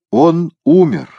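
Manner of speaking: high, loud, slow pronouncement; announcing. A narrator's reading. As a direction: declare the death dramatically. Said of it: "объявил Петернья". (торжественно объявить)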